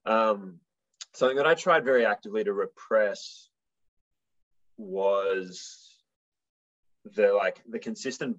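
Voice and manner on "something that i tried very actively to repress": ordinary delivery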